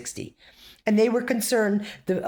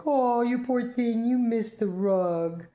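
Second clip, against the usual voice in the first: cooing to a pet; 5 semitones higher, slower than usual. Retold or imitated. Cooing at the dog.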